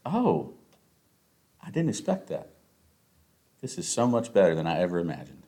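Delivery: soft, hushed voice of a dying man; lightly acted quiet deathbed wonder; dying man's last words. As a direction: murmur it softly, as if with last breaths. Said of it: "passing away with those last breaths".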